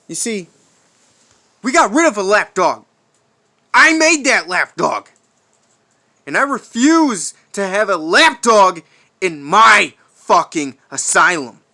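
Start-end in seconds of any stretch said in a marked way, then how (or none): 1.6-11.6 loud, fast snarl of contempt; furious rant. Acted. Warden raging about lapdogs in his asylum, furious rant. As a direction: bark it out like a madman.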